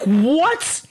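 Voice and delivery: high stunned what; shocked what. Retold or imitated. Reacting to the judge letting Jeff off, shocked. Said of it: "I'm like".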